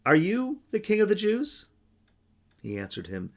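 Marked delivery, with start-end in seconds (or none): 0.1-1.7 voiced Pilate, pitched higher; Pilate's question. Pilate questioning Jesus, voice raised high. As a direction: ask it as the governor. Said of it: "Pilate asked him".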